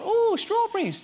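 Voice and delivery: high, drawn-out delighted "ooh"; mum carrying on. Retold or imitated. Retelling mum at Sunday dinner, imitated high cooing. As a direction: coo it slowly, high and gleeful. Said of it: "she just went on and on".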